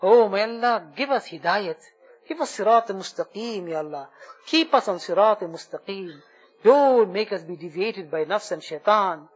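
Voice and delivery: fervent supplication, rising on each plea; heartfelt prayer. Acted. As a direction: pour out the dua to Allah, pleading. Leads an emotional dua.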